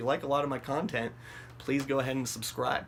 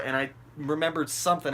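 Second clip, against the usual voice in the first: plain reading voice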